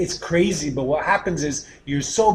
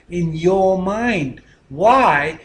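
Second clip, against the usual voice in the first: usual speaking voice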